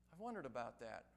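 normal talking voice

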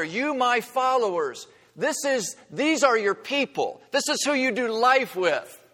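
high, loud impassioned sermon voice; rising pulpit fervor; fervent preaching. Acted. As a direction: preach it out to the congregation at full force. Passionate preaching climax.

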